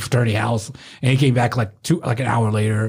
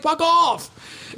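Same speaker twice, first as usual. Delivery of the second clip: very high-pitched mock outburst; retold angry dismissal. Doorman dismissing the guest's threat, high mock anger. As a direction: fling it out high, without more volume. Acted quarrel at the door.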